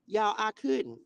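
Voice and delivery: ordinary delivery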